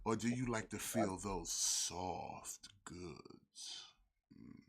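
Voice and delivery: slow, whispered seductive tone; sexy whisper. Pretend. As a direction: breathe it close and slow. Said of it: "talking in a sexy voice".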